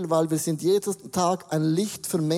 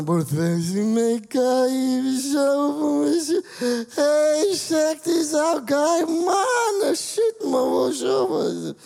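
put-on loud, rising, slurred drunk rambling; slurred drunk speech; 8 semitones higher, 3 dB louder, much slower than usual. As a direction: slur every word like a drunk. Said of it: "ich hab bewusst ein bisschen gelallt".

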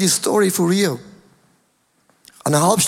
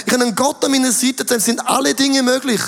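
ordinary talk

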